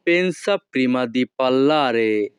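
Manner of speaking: each Italian word spaced out very slowly; slow teaching model. Shown as a demonstration. Teacher models Italian phrase for students, very slow. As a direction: spell it out slowly for learners to copy. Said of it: "Italian ভাষা".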